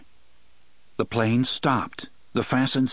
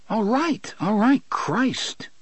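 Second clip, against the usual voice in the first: rattled, much higher; 10 semitones higher, slower than usual. A narrator's reading. Eddie gives in, rattled.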